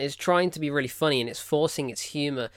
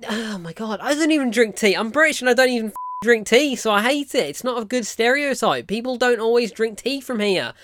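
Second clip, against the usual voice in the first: higher and louder, worked-up rant; real indignant outburst, raised high and loud; angry rant; 7 semitones higher, 5 dB louder than usual. Real, not acted. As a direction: vent about it like it's personal. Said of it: "why did this film make me so angry".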